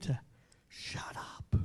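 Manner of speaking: whispered shut up. Real, not acted. Preacher telling the church, whispered. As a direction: breathe it out like a secret.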